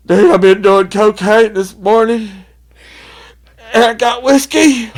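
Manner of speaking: high, loud, slow frantic complaint; panicky high drunk voice. Retold or imitated. Overheated drunk explaining to friends, high frantic whine. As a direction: whine it out like you're overdosing. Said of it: "there goes".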